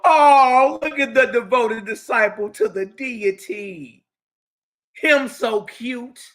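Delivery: loud, high sarcastic cooing; mock adoration. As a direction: gush mockingly, stretching the words out. Mock-fawning over the devoted believer.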